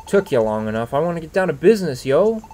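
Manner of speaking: fast, rising swagger ending high on yo; hammed-up thug bravado; brash punk Scraggy. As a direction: rattle it off like a cocky street kid.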